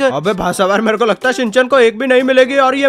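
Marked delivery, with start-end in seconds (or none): 0.0-1.3 थोड़ी नीची, खिंची आवाज़ में कुत्ता चॉप; "यार" पर ऊपर उठती टोन वाला चॉप; Chop dog voice. Pretend. (मज़ाकिया चॉप की हल्की सी आवाज़)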